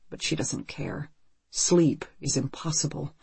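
ordinary delivery

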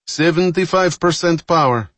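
high, loud status report; calling out. A narrator's reading. Engineer reports power during the speed run, calling out. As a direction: call out the power level firmly.

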